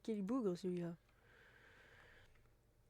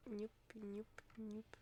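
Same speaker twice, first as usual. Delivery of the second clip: whispered giddy-up; 8 dB quieter, much slower than usual. Pretend. Chuchote en chevauchant.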